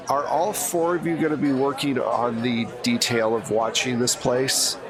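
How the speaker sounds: ordinary talk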